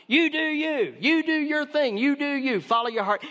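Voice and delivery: preacher mocking a popular slogan, mock-cheerful. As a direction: parody the self-help slogan. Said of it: "We say".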